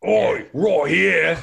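loud, drawn-out British peasant shout; British accent. Acted. British peasant answering the pirates, high, slow British holler. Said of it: "British peasants for blundering?".

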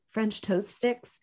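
normal talking voice

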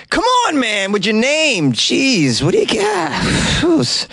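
mock-frustrated whine at the name; exasperated. Real, not acted. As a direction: throw up your hands at the name.

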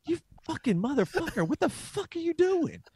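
comic self-berating yell; mock furious rant. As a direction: tear into yourself in mock fury. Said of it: "I was like".

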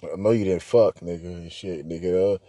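low, quiet accusation; deep and sly, pulling him up; friend's deep voice. Retold or imitated. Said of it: "He's like".

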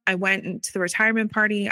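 normal talking voice

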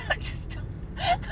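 high breathless laughing; cracking up too hard to speak; helpless laughter. Real, not acted. Telling viewers, breathless helpless laughter.

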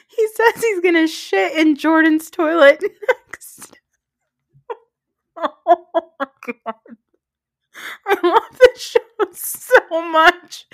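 high and loud, laughter bursting through; barely holding it together laughing. Real, not acted. Laughing hard over the show.

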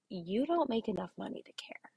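hushed, breathy whisper of the catchphrase. Shown as a demonstration. To stressed teachers, whispering the key phrase. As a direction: lean in and whisper it like a secret. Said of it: "My response to that is".

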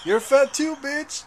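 high, loud mock yell; mock comeback. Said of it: "I'm just gonna be like".

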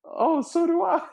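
high, eager mock girl; retold sketch girl agreeing, high chirp. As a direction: chirp it back brightly. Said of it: "she's like".

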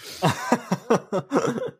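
high burst of real laughter. Real, not acted. Fan laughing at the alien's spelling mistake.

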